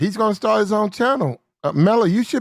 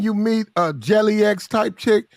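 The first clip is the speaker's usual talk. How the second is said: normal talking voice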